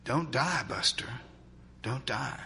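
soft, hushed plea, barely voiced; weak whisper. A narrator's reading. Announcer pleading with the fallen player, soft and hushed. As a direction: plead under your breath, not meant for air. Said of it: "just a whisper".

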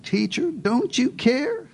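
a wounded disciple accusing God; slow accusing plea. Shown as a demonstration. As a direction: draw out the accusation, rising on care. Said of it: "they accused God of being indifferent".

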